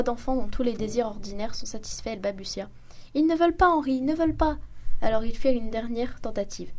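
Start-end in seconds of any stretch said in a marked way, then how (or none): 3.1-4.6 higher, louder, near sobbing; tearful stammer. A narrator's reading. Young wife tearfully pleading to her husband Henri. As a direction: plead like a spoiled child about to cry. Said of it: "une voix pleine de sanglots".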